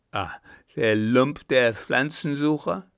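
contemptuous outburst. A narrator's reading. Clown sneers at his rival, loud contempt. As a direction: spit out the insult.

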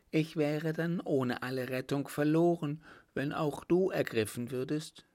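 high, gently pleading female voice; anxious woman. Acted. Woman warning Hugo she would be lost, high and anxious.

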